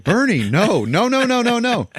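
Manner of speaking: high, loud mock plea to stop; imitating frantic onlookers calling out; alarmed group shout. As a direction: yell it in rising panic, hammed up. Everybody panics as Bernie walks out.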